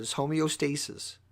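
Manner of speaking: ordinary delivery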